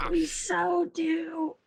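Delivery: high, drawn-out agreement through mock pain. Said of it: "holding onto her hand in pain".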